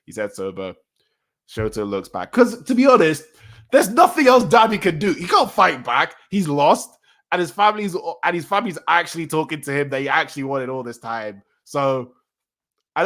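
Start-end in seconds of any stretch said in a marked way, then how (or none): 2.3-10.0 high, loud, emphatic argument; worked-up insistence. Real, not acted. Reader defending the beaten villain's reaction, high and worked up. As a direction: push the pitch up and insist.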